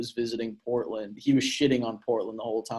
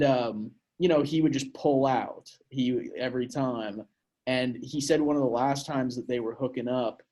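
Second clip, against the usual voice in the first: usual speaking voice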